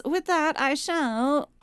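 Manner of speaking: ordinary delivery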